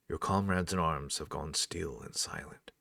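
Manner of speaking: ordinary talk, nothing marked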